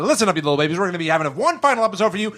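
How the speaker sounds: rapid, high, rushed delivery; really fast. Shown as a demonstration. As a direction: rattle it off like fine print. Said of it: "let's talk really fast".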